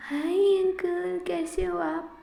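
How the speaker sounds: put-on high, slow little boy's greeting; a young son greeting his unknown father; child voice. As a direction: sound like a small child saying hi. Child greets stranger uncle.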